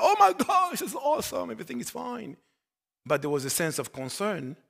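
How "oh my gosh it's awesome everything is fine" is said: high-pitched burst of acted relief; excited. Pastor acting out good test results, excited. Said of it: "then I get excited".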